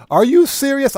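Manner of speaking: genuinely floored by the idea; real stunned question; astonished. Real, not acted. Reacts to air fryer tip.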